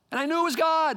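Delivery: high, loud preacher's cry; exclaimed conviction. Real, not acted. Preacher testifying he knew it was God, shouted conviction. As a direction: burst out with total certainty.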